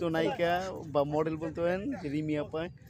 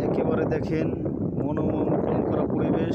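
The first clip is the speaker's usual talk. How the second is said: loud, raised voice over wind; shouting over wind; 8 dB louder than usual. Real, not acted. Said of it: "বাতাসে কিছু শোনা যাচ্ছে না".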